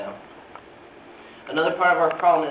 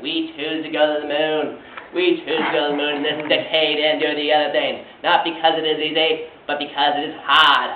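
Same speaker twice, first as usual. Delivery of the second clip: imitating Kennedy's Boston accent, louder and rousing; JFK Boston accent impression; 6 dB louder than usual. Student speaker imitates Kennedy's moon speech in Boston accent. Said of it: "And he did in this Boston accent".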